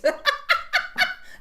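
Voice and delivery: a high, rising fit of real giggling; high giggle. Real, not acted. Mother cracking up at her McDonald's joke, high giggle. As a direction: crack up at your own joke.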